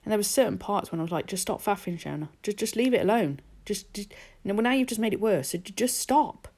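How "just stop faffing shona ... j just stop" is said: mock-despairing telling-off of herself; exasperated self-scolding. Nail artist scolding herself over faffing, exasperated.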